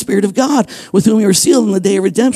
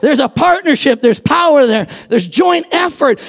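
raised, shouted preaching build; full-force preaching; 4 semitones higher than usual. Acted. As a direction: preach it hard, pushing every line.

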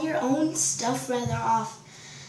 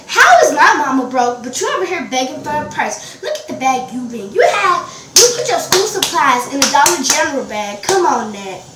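loud, indignant acted rant; heated comeback; 10 dB louder than usual. Her retort to classmate who called her mama broke, heated. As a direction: fire back loudly, retelling the quarrel.

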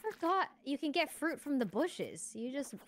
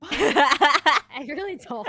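laughing; 2 semitones higher, 13 dB louder, much slower than usual. Real, not acted. Laughing, slowed teasing.

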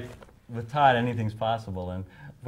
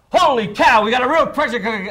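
much higher and louder, bursting with excitement; excited announcer; 14 semitones higher, 13 dB louder than usual. Retold or imitated. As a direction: yell it like a thrilled play-by-play man. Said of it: "and it was perfect".

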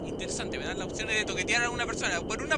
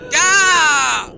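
sudden very loud cry; startled shout; 4 semitones higher, 16 dB louder than usual. Real, not acted. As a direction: jump and shout. Grito de susto. (grito de susto)

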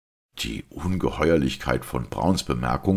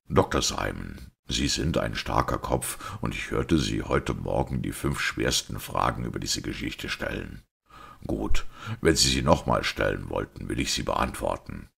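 lower-pitched, restrained priest voice; composed priest; 5 semitones lower than usual. Acted. Priest asking everyone to hear him out, composed. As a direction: play a priest calmly setting out the truth.

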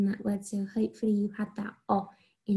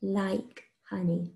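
very slow, each word spaced out; slow dictation; much slower than usual. Shown as a demonstration. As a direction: dictate it slowly for children to write. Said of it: "Three words".

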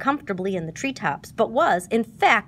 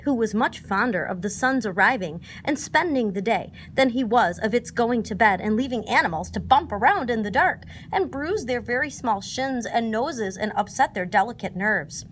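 ordinary delivery, nothing marked

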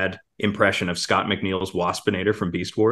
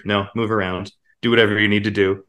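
ordinary talk

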